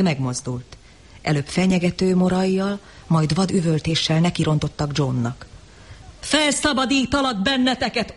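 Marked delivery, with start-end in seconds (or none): 6.2-8.1 higher, yelled Felszabadítalak benneteket; shouted vow. A narrator's reading. John shouting over the rushing mob, furious. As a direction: yell Felszabadítalak benneteket at the crowd. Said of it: "ordította John".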